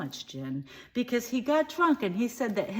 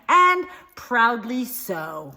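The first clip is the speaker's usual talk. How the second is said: proud; 4 semitones higher, 10 dB louder, much slower than usual. Acted. Declares proudly, drawn out.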